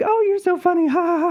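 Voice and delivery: very high, fake-laughing princess voice; sing-song mock princess with a fake laugh; stonewalling princess. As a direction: imitate a princess politely fobbing off a joke. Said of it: "they are just stonewalling us".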